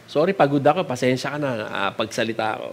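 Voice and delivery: low, soft, worn-out apology; acted tiredness. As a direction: murmur it like someone too tired to talk. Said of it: "Minsan, pagod ka".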